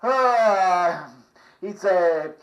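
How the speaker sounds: ordinary talk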